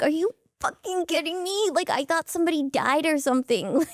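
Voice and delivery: high-pitched exasperated outburst; exasperated disbelief. Retold or imitated. Brittany reacting to Marco's calm call, high and exasperated. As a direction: let the disbelief burst out high. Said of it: "And I'm like".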